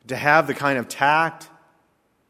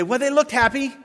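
high, louder mocking voice; mock naive remark; 12 semitones higher, 6 dB louder than usual. Preacher mocking what the crowd says, high and louder.

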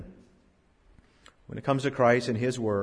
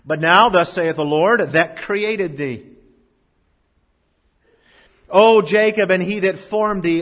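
loud, high, slowed declaiming; fervent scripture reading; 5 semitones higher, 7 dB louder, slower than usual. Acted. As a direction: proclaim the verses to the church. Reading scripture with pulpit force.

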